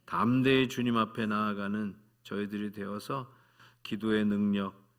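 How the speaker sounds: 열정적인 대표 기도; fervent prayer. Real, not acted. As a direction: pray aloud over the congregation. Leads closing prayer.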